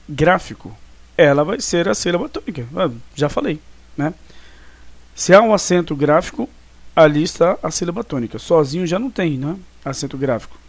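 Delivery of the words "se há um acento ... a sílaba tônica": normal talking voice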